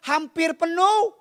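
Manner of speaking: high, loud, insistent pulpit delivery; preaching at full force. Acted. Preacher telling the congregation of a Holy Land trip, forceful preaching.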